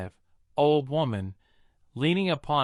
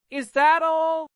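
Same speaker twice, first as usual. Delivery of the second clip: much higher, louder, teasingly light; amused godmother; 5 dB louder than usual. A narrator's reading. Godmother makes light of Cinderella's rags, playful. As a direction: brush the problem away playfully.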